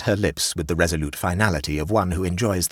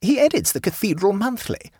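a hint of a fussy higher hostess; lightly voiced hostess; 3 dB louder than usual. A narrator's reading. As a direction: raise the pitch for the chatty hostess.

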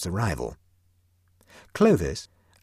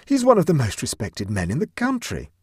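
protesting Huddle; 7 semitones higher, 5 dB louder than usual. A narrator's reading.

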